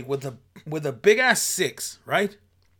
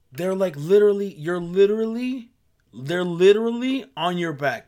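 louder, exasperated complaint; 2 semitones higher, 7 dB louder than usual. Real, not acted. Fed up with shoppers crowding him, louder exasperation. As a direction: really hammer on how close they get.

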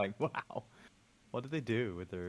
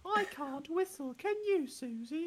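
very high, slow cartoon piglet voice; Peppa Pig; slower than usual. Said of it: "the random meme where it's like".

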